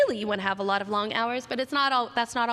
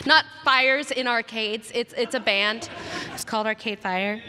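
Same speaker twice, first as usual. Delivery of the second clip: ordinary talk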